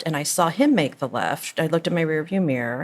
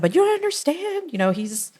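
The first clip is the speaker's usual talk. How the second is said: very high-pitched frantic protest; retold freak-out; 13 semitones higher than usual. Mother to emergency operator, frantic protest. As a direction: plead in a high retold panic. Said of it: "I freaked out".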